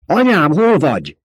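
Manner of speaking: high, loud frantic calling; yelling for his lost mother; desperate shout. A narrator's reading. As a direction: call into the dark in panic. Searching for buried mother.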